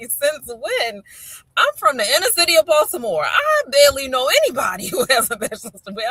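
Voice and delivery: incredulous protest. Retold or imitated. Mother from inner-city Baltimore disputing her son, incredulous protest. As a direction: argue in amused disbelief.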